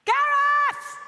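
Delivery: very high, loud sustained shout; insistent yell. Pretend. Host summons the unresponsive maestro, insistent yell. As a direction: yell the name to get his attention. Third call, maestro not answering.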